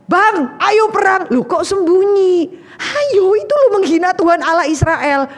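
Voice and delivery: put-on a boy tugging at his big brothers to go fight; eager young David. Young David urging his hiding brothers to fight, high and eager.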